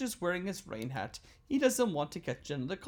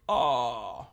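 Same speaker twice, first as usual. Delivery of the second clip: say ah; 5 semitones lower, 6 dB louder than usual. Acted. Patient says ah.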